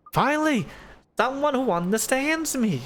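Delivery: high, overjoyed outburst; delighted. Acted. Pip thrilled that someone gets his dream, high and joyful. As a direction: burst out with relief and joy.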